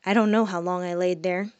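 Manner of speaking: ordinary delivery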